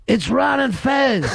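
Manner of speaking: acted-out cry of recognition; excited recognition. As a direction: exclaim it like a thrilled fan spotting celebrities. Attackers recognise the hosts, acted.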